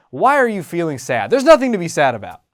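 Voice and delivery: put-on loud impatient parent dismissing child's fear; scolding parent. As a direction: scold the kid for feeling anxious.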